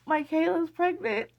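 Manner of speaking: high-pitched tearful squeak, imitating yourself; retold happy crying. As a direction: act out weepily breaking joyful news. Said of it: "I started crying again".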